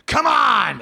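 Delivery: high mock shout from the crowd; mock heckler shout. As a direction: yell it like a rude heckler. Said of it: "he goes".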